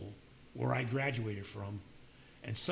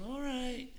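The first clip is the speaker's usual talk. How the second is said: breezy high agreement; 13 semitones higher than usual. Retold or imitated. Brushing off Irma's good luck.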